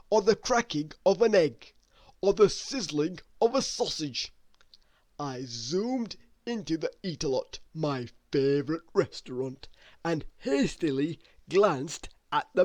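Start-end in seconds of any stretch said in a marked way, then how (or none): none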